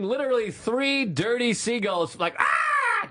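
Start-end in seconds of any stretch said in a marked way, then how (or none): 2.4-3.1 a sudden high yelp; quick high shriek; mock squawk. Mocking seagull squawk.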